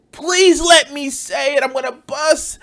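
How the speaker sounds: high-pitched, hammed-up pleading; mock desperate begging. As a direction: beg as if about to burst. Mocking someone desperate to say it.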